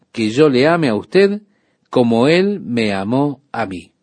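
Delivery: normal talking voice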